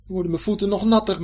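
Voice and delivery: mildly grumbling complaint. A narrator's reading. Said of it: "mopperde Joris dus".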